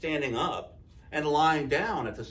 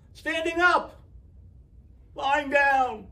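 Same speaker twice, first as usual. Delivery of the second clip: high, loud shouting; yelling; 12 semitones higher, 6 dB louder, slower than usual. A narrator's reading. Townspeople still yelling after a night of arguing. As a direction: keep shouting the slogans.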